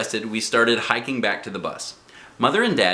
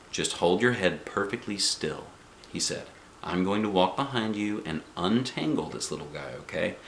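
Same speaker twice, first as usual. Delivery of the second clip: softer, steady and gentle; quiet calm instructions; 3 semitones lower, 7 dB quieter than usual. A narrator's reading. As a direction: keep the child still with calm words. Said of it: "real quiet and calm".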